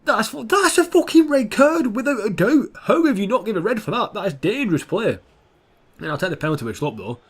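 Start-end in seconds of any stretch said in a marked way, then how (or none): 0.1-5.2 outraged shout. Real, not acted. Ranting about missed red card.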